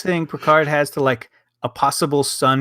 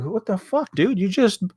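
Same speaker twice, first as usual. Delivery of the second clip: exasperated disbelief; 8 semitones higher than usual. Retold or imitated. Exasperated retort in imitated voice.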